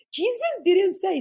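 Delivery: ordinary delivery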